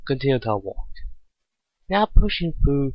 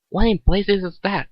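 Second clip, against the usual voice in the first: alarmed; 7 semitones higher, 3 dB louder than usual. A narrator's reading. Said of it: "she pondered out loud".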